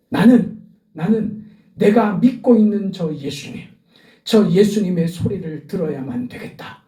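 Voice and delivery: a pastor's fervent peak in the sermon; full-force preaching. Acted. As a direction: preach it out to the congregation.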